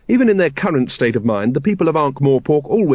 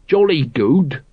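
usual speaking voice

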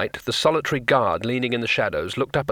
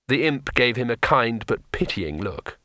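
plain reading voice

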